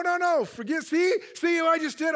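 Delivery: usual speaking voice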